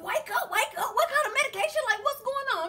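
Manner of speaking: very high, half-laughing squeal; urgent waking call. Retold or imitated. Woman waking him on FaceTime to ask, high and urgent. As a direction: pester a sleeper for answers.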